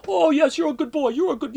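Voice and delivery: imitating an older Polish lady with a mild accent. Landlady welcoming the boy back, mock Polish accent. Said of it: "she had a heavy Polish accent".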